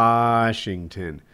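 Washington with a long drawn-out "Waaah"; mock the correction by stretching Washington slowly; slow, exaggerated first syllable on Washington. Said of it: "just to fucking mock me".

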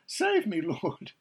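high, loud imitated cry for rescue; retold shout for help. Preacher re-enacts sinking Peter calling to the Lord, high acted shout. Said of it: "he shouted".

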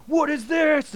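high, loud mock-accusing question; suspicious agent. Border agent finding a shell casing, mock indignant. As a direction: demand it like an overzealous agent. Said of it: "And they're like".

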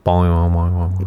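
flat, lazy boing, boing; low-energy cartoon boing. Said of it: "it's just literally a guy going".

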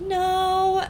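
high, loud, drawn-out cry of refusal; hammed-up cry of horror; mock scream. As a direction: imitate a horror victim wailing. Acting out the victim's cry.

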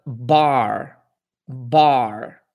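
bar with an American R at the end; English R demo. Shown as a demonstration. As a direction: say bar, curling the final R. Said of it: "Terceira palavra".

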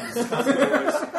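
laughing. Real, not acted. Guest explaining his drink.

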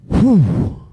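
loud, long breath blown out, shaken; heavy exhale. Retold or imitated. Dad taking in his son's lockdown story, heavy shaken exhale. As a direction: let the air out as it sinks in. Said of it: "And I just went".

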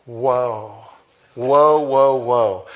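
acted run of amazed whoas; be bowled over, swelling on the middle ones; awestruck. Preacher showing how Paul wants readers to react, awestruck. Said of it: "He wants you to go".

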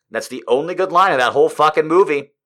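normal talking voice

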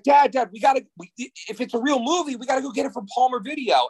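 put-on high, eager kid begging his dad; excited kid pleading. Boy begs dad to rent the movie, high and eager. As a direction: plead like a ten-year-old who can't wait.

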